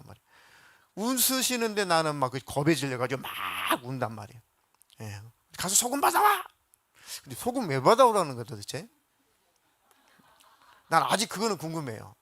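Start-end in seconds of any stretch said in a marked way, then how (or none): 5.6-6.4 high-pitched mock command of a mother; mother's order. Mother sending the bedwetting boy next door, high mock order. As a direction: squeak it out as a bossy mom.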